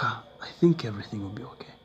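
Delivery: fast whispered hope; hopeful whisper. Real, not acted. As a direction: whisper it to yourself. His hopeful thought.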